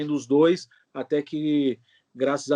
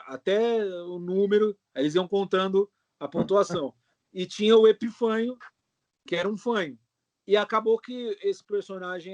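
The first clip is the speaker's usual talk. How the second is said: ordinary talk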